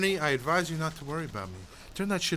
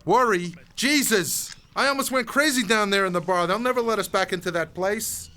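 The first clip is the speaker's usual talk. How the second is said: raised, exasperated pitch; agitated complaint; 4 semitones higher, 3 dB louder than usual. Acted. Man scolding his companion about the bar, agitated. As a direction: throw the word back and rant. Acted row over the bar scene.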